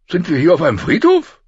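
high, loud, incredulous query rising at the end; displeased question. A narrator's reading. King frowning at the burial mounds, incredulous. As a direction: ask it loudly in disbelief.

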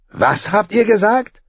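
high, cold threatening question; menacing young king. A narrator's reading. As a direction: dare her to repeat it. Joffrey menaces Sansa.

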